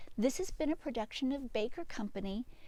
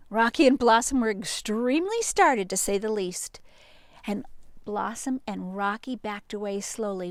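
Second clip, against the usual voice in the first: ordinary delivery, nothing marked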